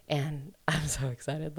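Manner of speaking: softly whispered, with a giggle; excited whisper. Real, not acted. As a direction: whisper it like a secret thrill. Gushing about the sequel.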